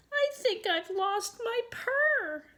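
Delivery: very high little kitten. Acted. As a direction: speak up high as a small kitten. Kitten confiding in her mother.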